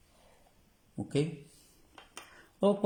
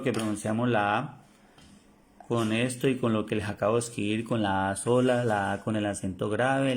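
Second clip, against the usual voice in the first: usual speaking voice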